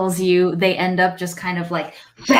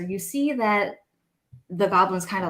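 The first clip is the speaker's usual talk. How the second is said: ordinary talk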